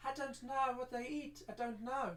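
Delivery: a hint of a timid little girl; young girl. Girl Jemima voiced in story.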